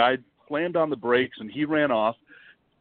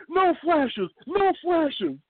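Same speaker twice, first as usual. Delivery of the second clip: high, frantic imitated guide's warning; panicked guide; much slower than usual. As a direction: squeak it out like a frightened guide. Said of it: "The guy's like".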